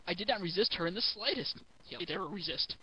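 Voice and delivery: normal talking voice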